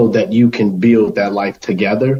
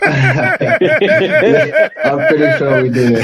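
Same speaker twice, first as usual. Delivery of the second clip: laughing through the words, high at the start. Real, not acted. Guest laughs at the host's joke about losing listeners, laughing. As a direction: chuckle it out, caught off guard. Lost half of our audience.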